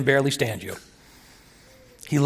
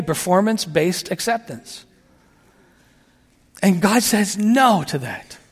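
ordinary talk, nothing marked

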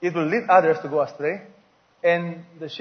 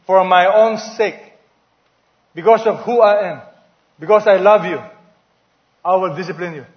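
voicing God; 6 dB louder than usual.

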